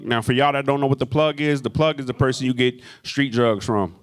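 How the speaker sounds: usual speaking voice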